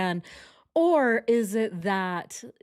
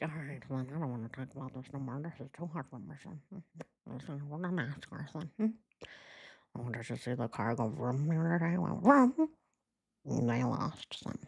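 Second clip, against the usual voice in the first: lower, softer gruff father; mock dad voice; 5 semitones lower, 6 dB quieter than usual. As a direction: grumble like an awkward dad changing subject. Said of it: "if Ronnie's like".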